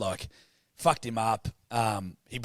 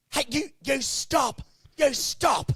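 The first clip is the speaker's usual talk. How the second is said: imitating her, much higher and hammed-up; high nagging voice scolding at the ice bath; mocking a bossy woman telling them to shower; mock shrill woman; 11 semitones higher than usual. Woman at gym told in high mock voice.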